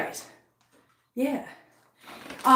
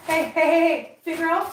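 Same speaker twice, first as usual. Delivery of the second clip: mild scolding call; 5 semitones higher than usual. Real, not acted. Scolding the cat Figaro.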